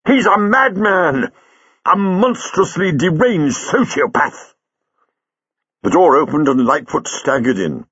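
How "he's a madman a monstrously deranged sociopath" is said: high, drawn-out furious denunciation; outraged, voice pitched way up; angry shout. Acted. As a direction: rage slowly, hammering out the words. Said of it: "he shouted in sudden anger".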